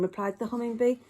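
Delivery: ordinary delivery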